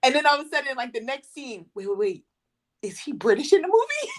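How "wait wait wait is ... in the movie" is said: high-pitched, mock-baffled question; imitating your own confused double take; retold confusion. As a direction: re-enact puzzled disbelief, pitched up. Baffled question to friend mid-film.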